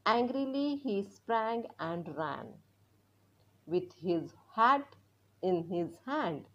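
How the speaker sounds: very slow poem reading; slow reading aloud. Shown as a demonstration. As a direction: read each phrase slowly for echoing. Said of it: "मोठ्याने वाचणार आहोत". (हळू कविता वाचन)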